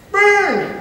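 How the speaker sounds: high, loud eager answer; panting kid announcing himself; excited, breathless. Acted. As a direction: call up out of breath from running. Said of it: "He sounded excited and out of breath".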